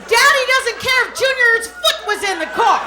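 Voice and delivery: usual speaking voice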